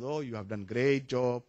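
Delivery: ordinary talk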